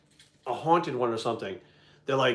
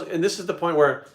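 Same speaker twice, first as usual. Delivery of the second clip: normal talking voice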